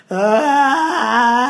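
a loud open wailing cry; loud woman's wail. Retold or imitated. Showing her friend how she cries now. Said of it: "ahora lloro como".

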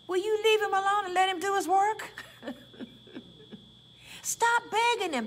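high, loud preaching call; preaching shout. Real, not acted. Preacher scolding the congregation, high and loud.

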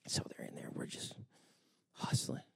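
hushed whisper aside; breathy whispered storytelling. Retold or imitated. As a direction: drop to a whisper like sharing a secret. Whispered aside during workout story.

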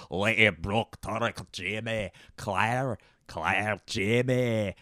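rolled-R Scottish burr; Scottish accent. Acted. Quoting a Scottish show's names, Scottish accent. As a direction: say it like a Scottish TV character. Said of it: "Scottish accent".

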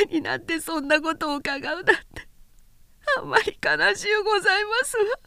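put-on tearful young woman. Nanae grieves.

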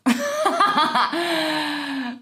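long high-pitched laugh. Real, not acted. Reading old journal to viewers, high laughter.

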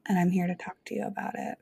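fast whispered aside, no voice. Real, not acted. Host whispering a quick promise to listeners. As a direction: rush it out as a breathless whisper.

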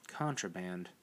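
low, soft, to herself; said quietly. A narrator's reading. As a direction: murmur it down at the book. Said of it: "Evelyn said quietly".

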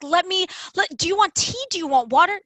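high-pitched, stumbling mock hospitality; imitated eager accommodating. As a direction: fall over yourself offering things, mimicking a flustered host. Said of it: "running to, like, accommodate her".

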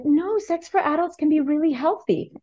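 lightly voiced matter-of-fact daughter, pitched high; high, assured kid correcting a friend; a hint of earnest little-girl certainty; imitated confident child. Voicing daughter's confident answer.

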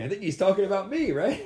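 light, high mock thought voice; amused inner voice. To himself, hearing the comment: amused high aside. Retelling his own thoughts mid-ceremony.

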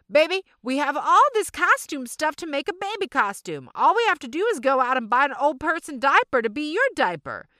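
a cheerful mom with it all planned out; mom's voice. Retold or imitated. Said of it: "And Mama says".

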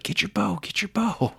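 high-pitched rushed urging under the breath; urgent hushed urging. Retold or imitated. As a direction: hurry him along in a tense whisper. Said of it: "and I'm like".

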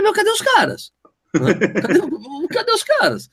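high, far-pitched mock confusion; puzzled runner. Champion retelling the home straight, high mock bewildered cry. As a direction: look around for the missing rivals, voice way up. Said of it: "Ele chegou e falou assim".

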